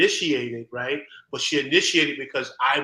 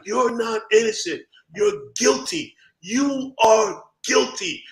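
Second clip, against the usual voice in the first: slow blunt verdict; 8 semitones higher, 3 dB louder, slower than usual. Retold or imitated. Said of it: "He's like".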